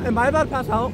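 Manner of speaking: usual speaking voice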